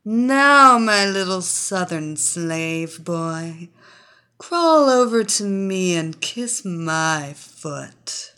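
loud, slow domineering order; commanding mistress. Acted. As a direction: command him slowly, the first word loud. Mistress commands hypnotized man.

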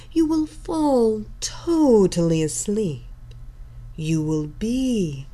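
slow, seductive mesmerizing tone; sultry hypnotic voice. Acted. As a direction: pull them under with every word. Hypnotist continues commands.

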